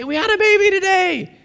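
very high, elated announcement; wildly excited. Acted. New parents calling people about their baby, wildly excited. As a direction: go crazy with the big news. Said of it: "They're going crazy".

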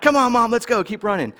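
triumphant urging. Retold or imitated. Triumphant son to mom.

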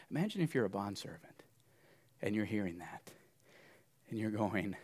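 soft breathy whisper drawing listeners in; quiet whispered storytelling; hushed whisper. Acted. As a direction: lean in and whisper the scene. Hushed setup of bondservant scene.

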